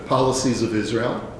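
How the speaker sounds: usual speaking voice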